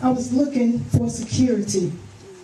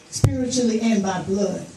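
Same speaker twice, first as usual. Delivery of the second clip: ordinary talk, nothing marked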